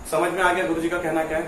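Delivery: normal talking voice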